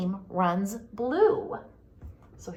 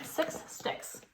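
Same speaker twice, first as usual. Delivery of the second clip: whisper; 10 dB quieter than usual. Acted. Whispered repeat.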